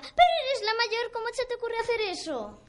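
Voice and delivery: hammed-up parent's reproach; scolding mother. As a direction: tell off the eldest in outrage. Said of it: "decía lo mismo y decía".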